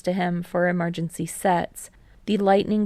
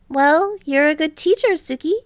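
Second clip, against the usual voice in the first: put-on higher, louder boyish teammate; Hinata, eager; 9 semitones higher, 6 dB louder than usual. A narrator's reading. Hinata bragging to Kei about deflections, higher, louder and eager. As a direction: bounce up, brag a bit.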